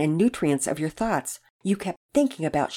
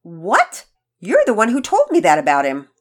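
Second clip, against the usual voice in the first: loud, raised-pitch outburst; indignant protest; 4 semitones higher, 9 dB louder than usual. Acted. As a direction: throw the blame back loudly. Sister indignantly protests being scolded.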